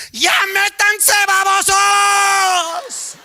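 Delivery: very high, drawn-out shout; yelling grandma. Acted. Grandma out the window to kids, piercing yell. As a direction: scream it out to the whole street. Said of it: "Grandma stick her head out the window".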